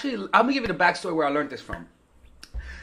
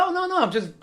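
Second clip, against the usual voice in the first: high, mock innocent voice; a lightly voiced girl backpedalling; imitating her reply; 11 semitones higher than usual. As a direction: play her brushing it off lightly, pitched up. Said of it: "She'd be like".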